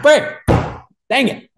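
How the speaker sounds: high, sudden mock outburst; frustrated. Golfer after playing like poop, comic frustrated yelp. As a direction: curse mildly in a high yelp.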